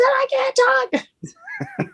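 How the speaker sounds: very high, slow wail on I can't talk; high drawn-out wail. Retold or imitated. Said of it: "I said".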